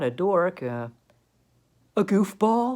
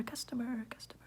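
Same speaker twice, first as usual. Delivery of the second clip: echo; 6 semitones higher, 14 dB quieter than usual. Acted. Echoing word fades to whisper.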